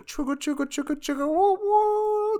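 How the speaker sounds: chugga chugga rising to a high woo; high train whistle woo; train noise. Retold or imitated. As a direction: imitate a steam train whistle, climbing high. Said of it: "it starts going".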